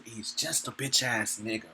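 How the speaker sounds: low, soft, slow put-down; quiet scornful aside; muttered insult. Real, not acted. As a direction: say it under your breath. Preacher insults the enemy.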